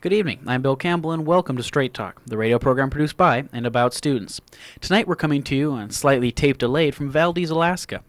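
loud, bright on-air welcome; radio announcer. Acted. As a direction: open the show like a DJ. Radio host opens the program.

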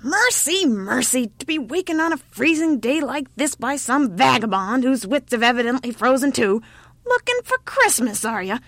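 put-on loud, high, indignant old woman; howling old woman. A narrator's reading. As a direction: wail and scold in disbelief. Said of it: "With a howl, the old woman".

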